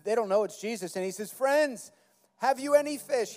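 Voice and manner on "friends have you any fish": mock yell, pitched up; calling out. Jesus hailing the fishermen from the beach, mock yelling out. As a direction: call out higher, only lightly raised. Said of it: "He's yelling out to them".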